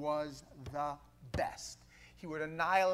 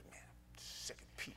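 whisper; 13 dB quieter than usual. Retold or imitated. Retold inner thought, whispered.

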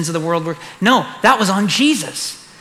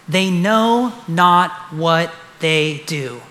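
slow repeat; much slower than usual. Shown as a demonstration. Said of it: "repeated slowly".

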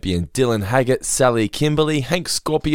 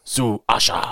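German [name] growl. Retold or imitated. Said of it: "as long as you say it like this".

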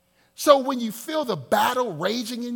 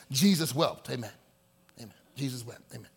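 low, perfunctory mock prayer; mock simple prayer; 8 semitones lower, faster than usual. Preacher imitates churchgoers' simple prayers, mock perfunctory. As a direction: rattle off a token little prayer, low. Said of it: "these, these simple prayers".

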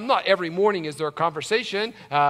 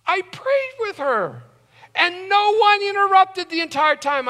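higher, louder testimony building; preaching at full force; 7 dB louder than usual. Acted. Pastor swearing to his church no one walked in, higher and louder. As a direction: build the miracle to a peak. Climax, prayer uninterrupted.

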